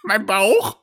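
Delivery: put-on high, pleading teen whine; whiny teenage son. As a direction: whine up at your father. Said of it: "Ich bin Glaurung".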